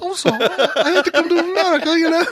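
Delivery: put-on high, prim British girl; lightly voiced British choir girl; mock British girl apologizing; girly British voice. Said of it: "You sound just like this chick".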